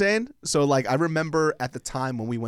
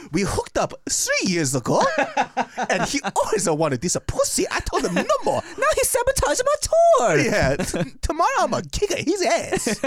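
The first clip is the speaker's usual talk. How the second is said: high, laughing put-on Japanese accent; hammed-up Japanese accent, laughing; mock Japanese accent; 6 dB louder than usual. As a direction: ham up the guide's Japanese accent as her. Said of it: "my Japanese accent came off kind of Italian".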